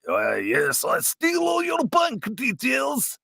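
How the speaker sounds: hammed-up Russian accent, high and slow; over-the-top Russian crook accent; bad Russian accent. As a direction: put on a dodgy Russian villain accent. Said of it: "about as best as my Russian accent can get".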